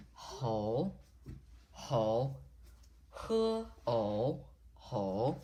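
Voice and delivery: "ho" dipping down then rising; falling-rising third tone. Shown as a demonstration. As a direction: say "ho" with a scooped pitch, split once into "hu, o". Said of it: "is the falling rising tone".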